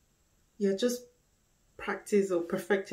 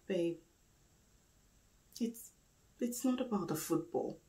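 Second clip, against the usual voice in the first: weary soft appeal; 8 dB quieter than usual. Acted. Weary appeal to distant partner.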